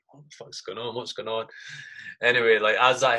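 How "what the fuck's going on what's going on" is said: much softer, barely voiced; asking under your breath in the dark; hushed confused mutter; hushed question.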